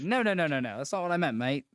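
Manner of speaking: a hint of a British guy protesting; mock British accent. Friend mimics a Brit clearing up a mix-up, light British accent.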